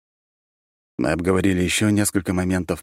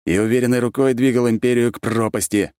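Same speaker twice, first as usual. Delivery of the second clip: raised, forceful retort; curt put-down; 7 semitones higher, 4 dB louder than usual. A narrator's reading. Archmage rebuffs the raving ex-emperor, firm and raised. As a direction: cut the ranter down to size. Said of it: "осадил я его".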